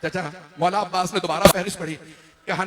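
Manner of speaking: usual speaking voice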